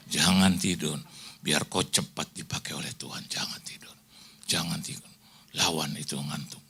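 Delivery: low, softened retold admonition dropping to a whisper; hushed motherly urging. Mother tells sleepy child to stay awake, hushed urging. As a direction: keep the child awake under your breath.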